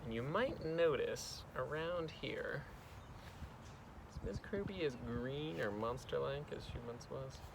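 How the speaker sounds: soft narration fading toward a whisper; gentle, quiet read-aloud; hushed storytelling. A narrator's reading. Hushed narration of a calm moment.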